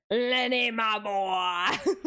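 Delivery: lightly voiced cowboy calling out; a hint of Arthur, cracking up; Arthur Morgan line. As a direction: call out to Lenny like an old friend. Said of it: "the mission with Lenny".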